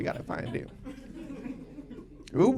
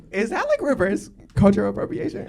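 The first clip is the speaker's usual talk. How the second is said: ordinary talk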